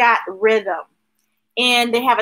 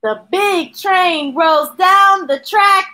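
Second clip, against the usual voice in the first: slow, loud storytelling; 6 semitones higher, 4 dB louder, slower than usual. Acted. Reading train page.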